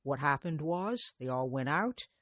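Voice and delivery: ordinary delivery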